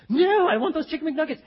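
loud, high stubborn outburst; mock infuriated. Customer rejecting every other menu offer, mock infuriated. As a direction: imitate an outraged customer insisting. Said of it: "man, she became infuriated".

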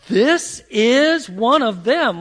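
slow, loud, leaning on every word; gasp, then drive each word home; emphatic accusation. Acted. Servant girl pointing Peter out to the bystanders, emphatic. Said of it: "She's putting a lot of emphasis on this".

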